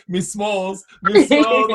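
rising to a loud mock call; repeated polite address. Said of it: "all he did was".

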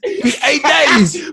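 high-pitched insistent cry; insistent calling out. Real, not acted. Co-host pressing friend on holidays, high and insistent. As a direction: hammer it home in a high voice.

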